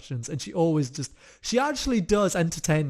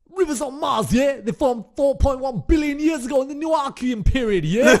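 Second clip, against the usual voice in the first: high, loud mock gangster; hammed-up intimidating gangster; 9 semitones higher, 7 dB louder than usual. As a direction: reel off science like a street tough. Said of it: "in a gangster voice".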